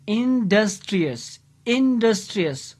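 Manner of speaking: industrious drawn out slowly as in, dus, tri, ous; syllable by syllable. Shown as a demonstration. Teacher counting four syllables, industrious said syllable by syllable. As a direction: break industrious into four slow beats. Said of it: "four syllable".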